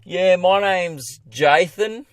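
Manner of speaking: put-on slow, drawn-out bogan kid voice. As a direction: lay on a dim bogan drawl, very slow. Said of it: "some bogan piece of shit name".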